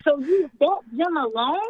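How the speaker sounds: ordinary delivery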